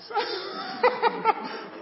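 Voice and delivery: high laugh breaking out; high burst of laughter. Real, not acted. Laughing at the eight hundred Pacos, high burst of laughter. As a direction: laugh out loud at the punchline.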